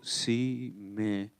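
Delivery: slow hushed reading; slow and soft. Shown as a demonstration. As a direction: read it slowly, almost under the breath. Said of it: "lo leo despacio".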